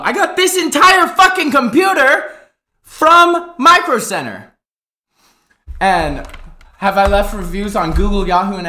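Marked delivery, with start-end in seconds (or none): 0.0-4.5 laughing, much higher and louder; laughing reveal of the whole computer; laughing exclaim. Real, not acted. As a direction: blurt it out through laughter. Punchline of gear list.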